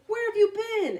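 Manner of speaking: high, loud eager question; excited. A narrator's reading. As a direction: burst out with excitement. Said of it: "asked the fish excitedly".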